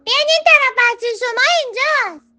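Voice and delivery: very high, loud shout across distance; calling out. Acted. Butterfly shouts to mother ant across the desert, loud. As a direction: call the mother ant from far off. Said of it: "شاپرک داد کشید".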